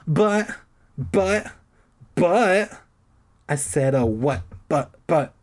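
stammering in panic; sputtering. A narrator's reading. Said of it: "I sputtered".

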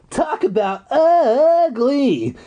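high, slow, crackling sneer; crackling old man. A narrator's reading. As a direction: sneer it out slowly like an old crone. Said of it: "He crackles as he glares".

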